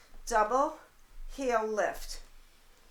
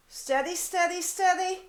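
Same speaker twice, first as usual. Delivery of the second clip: urging steady; 7 semitones higher, 3 dB louder than usual. Real, not acted. Coach urging class to hold.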